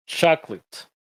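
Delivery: two-syllable chocolate, CHAK-let; English chocolate. Shown as a demonstration. Teacher demonstrates the alternate English chocolate to students. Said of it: "Hay veces que lo ponen con ese sonido".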